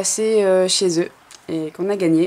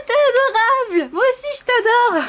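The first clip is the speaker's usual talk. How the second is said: voix très aiguë et mignonne; petite voix aiguë; 3 dB louder than usual. Pretend. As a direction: piailler la réponse tout en haut. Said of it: "la petite voix qui me fait toujours rire". (petite voix aiguë et attendrie)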